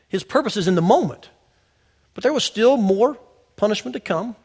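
usual speaking voice

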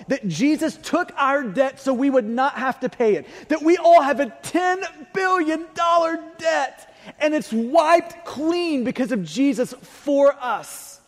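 full-force preaching. Acted. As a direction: preach it out to the whole room.

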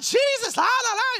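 high, loud mock prayer shout; imitated yelling. Preacher imitates men at the altar call, yelling high and loud. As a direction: yell it out like frenzied altar workers.

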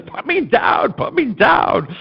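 high, shouted tantrum, words drawn out; mock-tearful high yelling; retold crying child. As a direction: imitate a kid wailing in a stranger's arms. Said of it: "And I'm crying now".

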